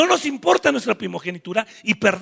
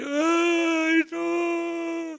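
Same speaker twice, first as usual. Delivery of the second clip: whining crowd; 6 semitones higher, 5 dB quieter than usual. Acted. People whining.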